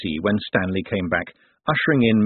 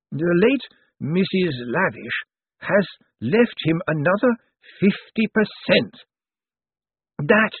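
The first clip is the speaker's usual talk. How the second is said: higher, slow, dusty legal voice; dry old zombie lawyer; 10 semitones higher, slower than usual. Acted. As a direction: ancient lawyer weighing every word. Slant names the dog chairman.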